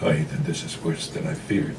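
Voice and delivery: hushed dread. Acted. Mother dreads telling the truth.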